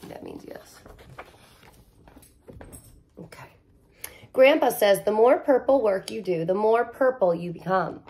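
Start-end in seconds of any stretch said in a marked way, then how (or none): 0.1-3.5 breathy unvoiced aside; whispered aside. Reader whispers an aside to the kids, hushed. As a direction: whisper it to the listeners.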